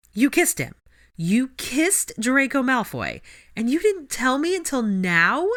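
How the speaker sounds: high, loud, slow disbelief; incredulous outburst. Acted. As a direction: shout it in shocked disbelief. Ginny incredulous.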